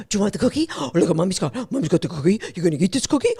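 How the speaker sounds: lightly voiced coaxing dog owner; a mild sing-song coax to the dog; cheerleading owner. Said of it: "you go".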